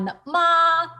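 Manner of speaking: "ma" held high and level; first tone. Shown as a demonstration. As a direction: hold "ma" high and flat. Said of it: "the first tone".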